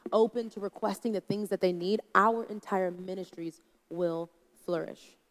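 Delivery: ordinary talk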